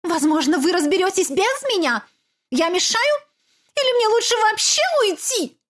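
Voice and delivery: high, wounded mock politeness; offended sarcasm. Mother sulking at Olaf, high and sarcastic. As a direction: play the martyr, biting.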